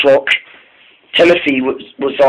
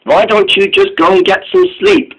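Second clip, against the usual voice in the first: Neville's voice; 13 semitones higher than usual. A narrator's reading.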